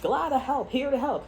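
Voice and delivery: high, sing-song mock cheer; mock helpfulness. Acting out the meddler's offer, high mock-helpful chirp.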